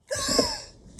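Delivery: huge, high, loud gasp; dramatic gasp. Acted. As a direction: gasp in exaggerated shock.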